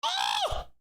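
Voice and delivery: very high-pitched "oh"; astonished gasp. Real, not acted. Streamer stunned by Plymouth's lead, astonished cry.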